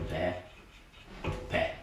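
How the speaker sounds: "Pe pe" as two separate open "peh" syllables; open E sound. Shown as a demonstration. Teacher drills the E sound, "Pe pe" as open peh, peh. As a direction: say "Pe pe" peh, peh with a pause between. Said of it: "That's the song E".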